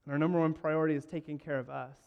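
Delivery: usual speaking voice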